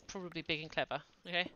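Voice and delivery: ordinary talk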